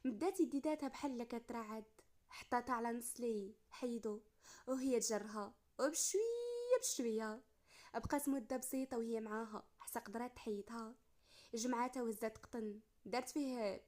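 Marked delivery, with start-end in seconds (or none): 5.8-7.4 high, drawn-out and slow; slowly, slowly. A narrator's reading. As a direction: stretch it out gently, very slowly. Narrator voices slow careful pulling.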